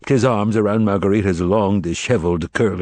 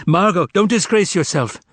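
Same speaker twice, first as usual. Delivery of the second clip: alarmed plea; 9 semitones higher than usual. A narrator's reading. Master pleading in alarm.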